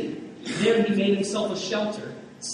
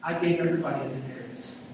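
God's gentle answer; 4 semitones lower, 6 dB quieter than usual. Retold or imitated. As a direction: gently set Jonah straight.

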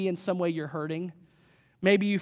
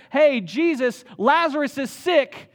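higher and louder, rushing the alarm; urgent news; 5 semitones higher, 6 dB louder than usual. Retold or imitated. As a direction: call out the bad news in a panic. Messenger rushing news to Jesus.

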